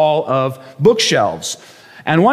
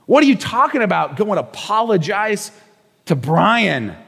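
retold indignation; 6 semitones higher than usual. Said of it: "what I said to my wife".

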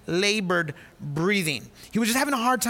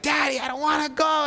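put-on whiny child; 6 semitones higher than usual. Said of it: "he's screaming".

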